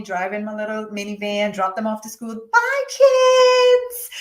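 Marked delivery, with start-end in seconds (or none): 2.5-4.1 high, loud sing-song goodbye; happy mommy. Retold or imitated. Mother dropping kids at school, fake sing-song cheer. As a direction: chirp it brightly, all pretend.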